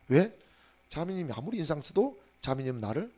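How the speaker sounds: normal talking voice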